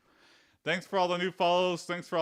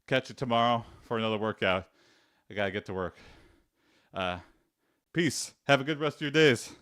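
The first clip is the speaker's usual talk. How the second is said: ordinary talk, nothing marked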